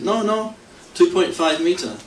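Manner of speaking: playful retold objection, lightly foreign phrasing; eager protest. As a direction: protest brightly to the cop, pretending. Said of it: "And I said".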